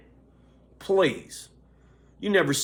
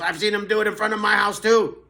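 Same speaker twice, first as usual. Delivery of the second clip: quick, higher, louder imitation of Dan; quoting Dan; 7 semitones higher, 5 dB louder, faster than usual. Telling about the neighbor, quick higher imitation. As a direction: rattle it off like the neighbor. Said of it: "Dan goes".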